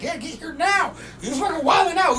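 high-pitched mock urgent caller; urgent coworker. Coworker on the phone with police, urgent imitation.

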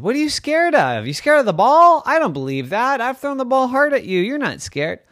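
high, loud mom questioning; disbelieving mommy. A narrator's reading. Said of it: "And Mommy said".

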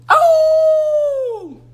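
a high startled cry of fright; frightened cry. Acted. Children hear wolves howl, a high frightened cry.

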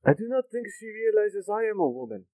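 a deadpan side comment to a friend; dry aside. Acted. Bizarra murmuring to Kaylor, a dry aside. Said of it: "Bizarra leans over to you, Kaylor, and just kinda".

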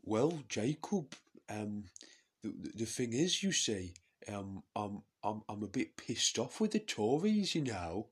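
put-on voice of a woman MP, halting; lifted, emphatic politician character with stammered starts; Jess Phillips impression. Fed-up MP answering her opponent, halting put-on voice. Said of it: "Very good Jess Phillips voice".

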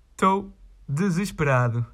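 hammed-up despairing groan on "Estou desesperado"; mock despair. Fed up with the requests, he moans in mock despair. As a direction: play it like a tragic hero, half joking. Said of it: "É o que eu digo".

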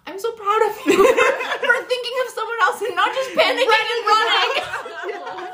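high, laughing mock praise; proud, laughing. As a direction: gush with pride through laughter. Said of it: "And he was like".

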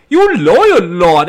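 loud, hammed-up mock American accent; Rachel. Podcaster quoting Rachel to Dick, in a mock American accent. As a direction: put on a bad American accent, loudly. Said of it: "a whole bunch of great American accent".

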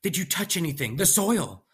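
grabbing his shoulders, desperate; urgent frantic question. Acted. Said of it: "came up to me and grabbed my shoulders".

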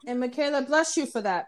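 usual speaking voice

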